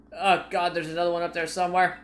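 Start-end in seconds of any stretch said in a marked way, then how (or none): none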